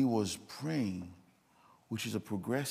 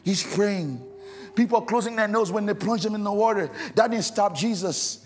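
loud, high, impassioned preaching climax; full force preaching; 9 semitones higher, 10 dB louder than usual. Acted. Preacher to the congregation, building to a loud, high passionate cry. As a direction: shout the story home to the church, building.

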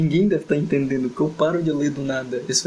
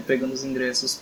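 normal talking voice

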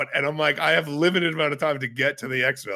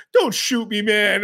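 mock-scared higher voice; higher-pitched imitated plea; retold fearful plea; 5 semitones higher, slower than usual. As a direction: plead for your life, imitating another player. Retold plea not to shoot.

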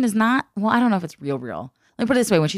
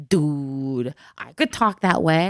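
imitating classmates' accent; 6 semitones lower, slower than usual. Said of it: "they sounded like that".

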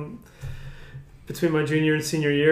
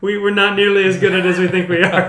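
laughing; 2 semitones higher, 7 dB louder than usual. Real, not acted. Retelling John's words, laughing.

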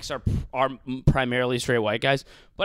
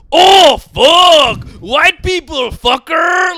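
loud, high-pitched cartoonish Mexican comic shout; Carlos Mencia; 12 semitones higher, 14 dB louder, much slower than usual. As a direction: holler it like a goofy Mexican caricature. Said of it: "me doing a racist impression of a Mexican guy".

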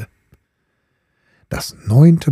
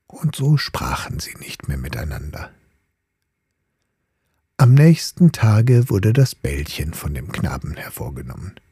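usual speaking voice

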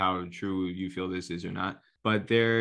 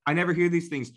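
usual speaking voice

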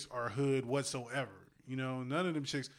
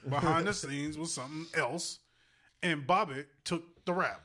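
ordinary talk, nothing marked

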